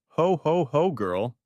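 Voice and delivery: booming Santa ho ho ho; jolly Santa greeting, grinning; big jolly Santa voice; Santa laugh. Acted. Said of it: "I said, grinning".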